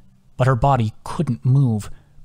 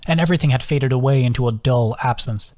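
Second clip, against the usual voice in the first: ordinary delivery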